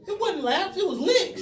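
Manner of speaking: loud laughing punchline. Real, not acted. Laughing reveal of punishment.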